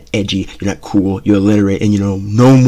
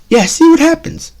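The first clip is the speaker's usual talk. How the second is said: much higher taunting warning; threatening parent; 5 dB louder than usual. Retold or imitated. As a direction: dare the kid to try. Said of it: "type tone in their voice".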